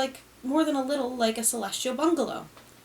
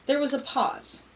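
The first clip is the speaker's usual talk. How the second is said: ordinary delivery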